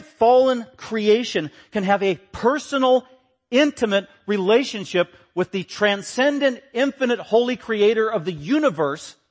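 high, forceful pulpit delivery; impassioned preaching. Acted.

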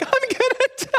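squeaky high mock terror; a high, panicked squeak of fear. Missionary kid squeaks in terror at the fight. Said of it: "I'm hanging there like".

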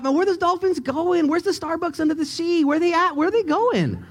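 high-pitched, comic bewilderment; excited wondering. Retold or imitated. Preacher recalling his thoughts about the dolphins, high-pitched excited wondering. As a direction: fire off questions in a high, amazed voice.

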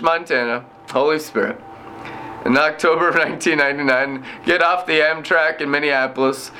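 ordinary delivery

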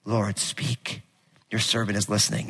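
hushed, low Samuel answer; quiet prayer. Acted. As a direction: speak it gently into the stillness.

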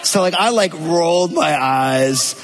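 ordinary talk

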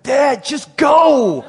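loud, high shout with GO drawn out; son's exasperated shout. Retold or imitated. Son to his frozen dad at the baptism, exasperated shout. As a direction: holler it, dragging out the last word.

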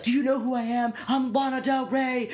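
high put-on diva voice; high voice of a diva at the phone store; entitled celebrity. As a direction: play the offended star. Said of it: "she was like".

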